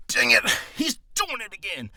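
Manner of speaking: first-draft cranky character voice; lightly cartoonish griping; kiddie-sounding old man. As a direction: complain in a childish put-on voice. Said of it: "it sounded kinda like Kitty".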